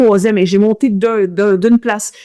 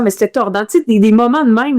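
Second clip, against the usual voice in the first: ordinary talk, nothing marked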